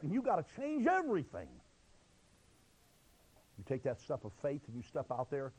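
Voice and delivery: ordinary talk, nothing marked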